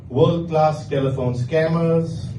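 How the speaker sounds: ordinary delivery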